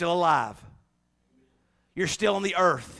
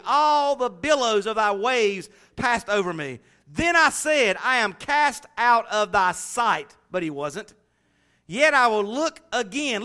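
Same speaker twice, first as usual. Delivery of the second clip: solemn, slowed Bible reading; scripture reading; 7 semitones higher, 7 dB louder than usual. Acted. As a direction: read Jonah's prayer with gravity. Reading Jonah's prayer aloud.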